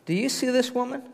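"Do you see this woman?" lifted high on "this"; pointed question. Acted. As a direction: make him really look. Jesus confronts Simon.

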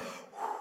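soft, breathed-out "whew"; hushed puff of relief; acted relieved exhale. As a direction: let out a mock sigh of relief. Driver relieved at escaping the cop.

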